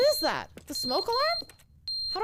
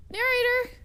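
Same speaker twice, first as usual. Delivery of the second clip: louder call for him; 5 semitones higher, 6 dB louder than usual. Real, not acted. Player calling loudly for the absent narrator. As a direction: shout his name to find him.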